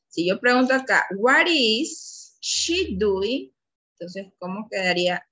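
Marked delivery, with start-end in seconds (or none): 1.2-3.5 very slow, higher-pitched classroom question; drawn-out, raised teaching voice; slow modelled question. Shown as a demonstration. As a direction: stretch each word out for learners.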